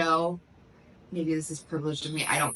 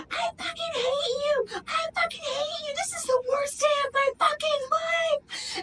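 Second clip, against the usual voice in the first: imitated screaming meltdown. Acting out a girl crashing out after the race, screaming. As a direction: screech it like an imitated meltdown, far above your usual pitch. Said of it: "screaming out of nowhere".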